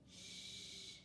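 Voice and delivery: long soft shush; hushing. A narrator's reading. Fox quieting frightened friends, soft shush. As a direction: hush them gently. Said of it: "said Fox".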